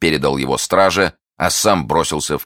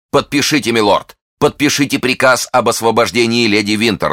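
high, pressing demand; insistent; 6 semitones higher, 4 dB louder than usual. A narrator's reading. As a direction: press him urgently to comply. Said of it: "настаивал Фельтон".

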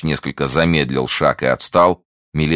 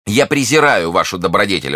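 higher, louder scornful cry; defiant exclamation; 6 semitones higher, 5 dB louder than usual. A narrator's reading. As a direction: throw the contempt at them. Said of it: "воскликнула миледи". (презрительный возглас)

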